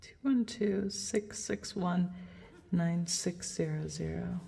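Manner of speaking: low, soft counting of digits; murmured to herself. Real, not acted. Singer alone in the locked closet, murmuring the hotel number as she dials. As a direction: read the number quietly to yourself. Reading phone number while dialing.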